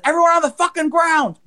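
high-pitched imitation of a robber's command; mock robber yell. Robber storming back into the store, mock yelled order. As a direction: act out a gunman ordering everyone down.

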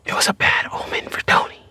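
secretive stage whisper; hushed, confidential whisper; ominous whisper. Acted. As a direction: whisper the warning aside. Secret aside away from salesman.

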